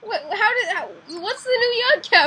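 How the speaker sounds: high, laughing disbelief; incredulous. Real, not acted. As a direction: demand it in shocked amazement. Stunned by renamed player.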